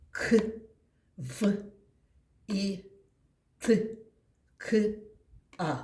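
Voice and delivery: К, В, І, Т, К, А, each sound said separately with a pause; sounding out letters. Shown as a demonstration. Teacher names each sound of the word in turn with the class. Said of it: "назвемо всі звуки по порядку".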